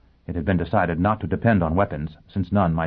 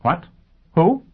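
high, sharp, louder questions; demanding; 9 semitones higher, 4 dB louder than usual. A narrator's reading. Lawyer demanding to know who it is, sharply. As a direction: demand an answer, voice leaping up. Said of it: "Sheffield demanded".

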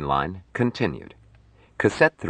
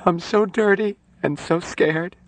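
high-pitched acted crying voice; tearful; 14 semitones higher than usual. Nurse breaks down again, crying. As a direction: wail it plaintively through tears. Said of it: "She started to cry again".

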